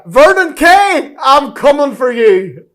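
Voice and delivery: high, loud, slow threat; hammed-up wrestling callout; mock challenger. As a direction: taunt him like a wrestler. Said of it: "To be the man".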